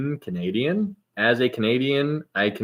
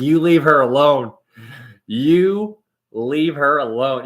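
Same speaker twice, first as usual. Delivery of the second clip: louder, higher, slow mock scold; hammed-up protective warning; 5 semitones higher, 7 dB louder, slower than usual. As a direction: defend her like a parent scolding. Defending the GPS voice.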